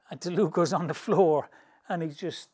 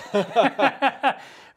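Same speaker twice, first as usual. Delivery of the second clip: loud high burst of laughter; laughing; 7 semitones higher, 7 dB louder than usual. Real, not acted. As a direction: cracks up at his own punchline. Laughing at own joke.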